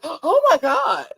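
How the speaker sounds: high, slow hammed-up gasp; mock shocked gasp. Podcaster imitating Kayla gasping, slow high mock shock.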